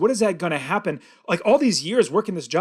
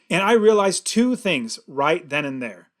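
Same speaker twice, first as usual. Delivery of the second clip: ordinary talk, nothing marked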